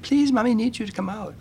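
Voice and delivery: put-on high, pleading mother begging her toddler; pleading mom. Mother begging her son to climb out, high pleading. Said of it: "she begged".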